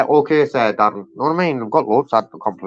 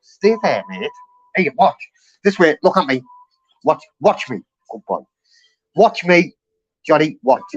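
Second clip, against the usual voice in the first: dog commands; 3 semitones higher, 5 dB louder than usual. Real, not acted. Training the dog Johnny.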